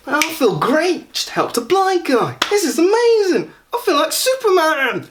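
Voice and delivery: high, drawn-out Essex self-congratulation; mock Essex accent. Passer-by pleased at helping a blind guy, mock Essex accent. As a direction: gloat in a squeaky Essex caricature voice. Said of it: "I'm doing that accent".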